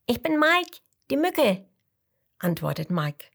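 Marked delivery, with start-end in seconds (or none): 0.1-1.7 tiny mosquito voice. A narrator's reading. Mosquito introduces himself.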